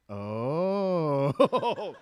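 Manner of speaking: loud breathy swoon; wife swooning at a shirtless Thor, loud drawn-out oh. Retold or imitated. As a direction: sigh it out like she just saw Thor shirtless. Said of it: "this is the exact noise my wife made".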